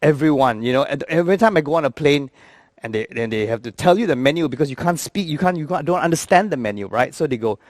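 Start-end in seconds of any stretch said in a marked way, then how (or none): none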